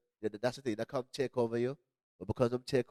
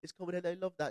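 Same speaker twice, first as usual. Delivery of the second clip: high-pitched imitation of smitten girls; admiring girls; 9 semitones higher than usual. Retelling New York girls loving it, imitated admiring girls. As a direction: mimic impressed girls, voice pitched way up. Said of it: "They're like".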